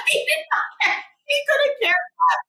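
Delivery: very high, loud giggly squeal; real hysterical amusement; laughing hard. Real, not acted. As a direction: squeal the words through laughter. Cracking up at clerk's indifference.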